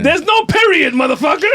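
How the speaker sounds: high, loud, laughing yell; mock-exasperated laughing shout. Real, not acted. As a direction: bust his chops, shouting through a laugh. Teasing a repeated name correction.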